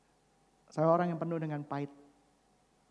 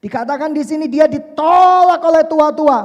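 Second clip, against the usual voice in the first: loud, high forceful preaching; preaching at full force; 11 semitones higher, 19 dB louder than usual. Acted. Preacher proclaiming scripture to the congregation, forcefully.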